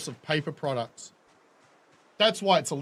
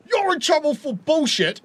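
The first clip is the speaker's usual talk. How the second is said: mock cop; 10 semitones higher, 4 dB louder than usual. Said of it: "cops are allowed to show up and go".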